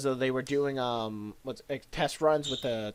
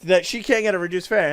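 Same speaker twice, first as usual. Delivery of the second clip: loud, higher angry rant; mother yelling at the agent, loud and high; furious rant; 4 semitones higher, 8 dB louder than usual. Retold or imitated. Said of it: "She's like".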